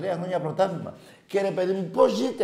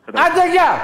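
loud, high shout goodbye; dismissive yell; 9 dB louder, slower than usual. Real, not acted. Host hanging up on the caller with a loud dismissive shout. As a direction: bark him off the line.

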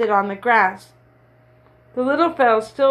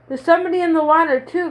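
fast and higher, alarmed call across the water; alarmed cry; 6 semitones higher, faster than usual. A narrator's reading. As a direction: blurt it out in a rush of alarm. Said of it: "cried Morris".

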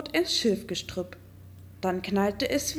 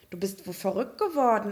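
plain reading voice

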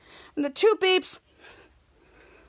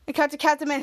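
plain reading voice

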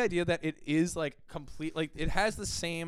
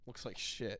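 low, quiet virtual assistant; hushed Siri-style weather report; 5 semitones lower, 11 dB quieter than usual. Acted. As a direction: deliver it softly, like a deadpan voice assistant. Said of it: "she says in her, like, Siri voice".

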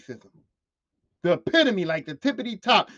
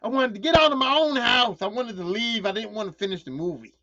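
ordinary delivery

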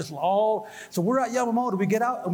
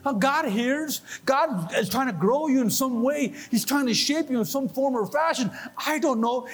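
raised, fervent preaching voice; 6 semitones higher, slower than usual. Acted. Pastor urging congregation not to give up, preaching high and fervent. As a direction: urge them on, full of conviction.

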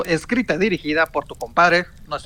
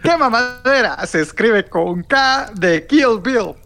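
slow, higher announcer read again; slow announcer retake; 4 semitones higher, slower than usual. Acted. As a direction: repeat the spelling slowly, with emphasis. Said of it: "va de nuevo".

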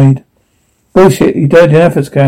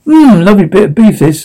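normal talking voice

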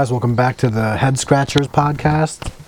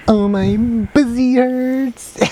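very high, drawn-out mimicry; imitating mock whine; 13 semitones higher, much slower than usual. Host imitates coworker's catchphrase, slow high mock wail. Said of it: "He literally says it all fucking day".

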